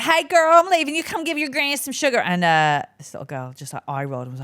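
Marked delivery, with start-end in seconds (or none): 0.0-2.2 high, loud Texan granny call; Texas granny. Retold or imitated. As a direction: ham up a Texan grandma demanding kisses. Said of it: "her granny was very Texas".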